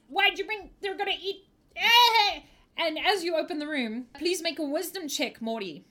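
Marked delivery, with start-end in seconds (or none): 0.1-2.4 high-pitched goblin squawk; high goblin protest breaking into a yelp; panicky goblin. Acted. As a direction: squeal it like an alarmed goblin, ending loud. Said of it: "The goblin turns and says".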